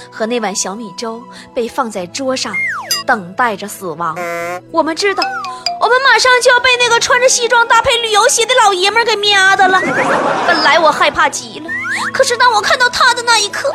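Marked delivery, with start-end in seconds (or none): none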